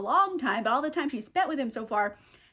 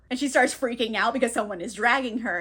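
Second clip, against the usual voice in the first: usual speaking voice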